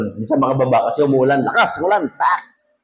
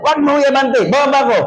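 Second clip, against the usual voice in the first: much higher hammed-up yell; mock yell; 9 semitones higher than usual. Passenger yelling at driver not to move forward, mock yell. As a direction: shout to stop the jeep, half-comic. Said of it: "Sisigaw ng pasahero".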